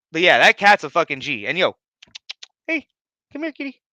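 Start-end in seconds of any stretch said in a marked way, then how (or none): 2.7-3.8 much higher, gentle pet voice; coaxing the cat. Real, not acted. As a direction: sweet-talk the kitty over. Calling the cat over.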